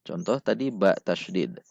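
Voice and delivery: ordinary delivery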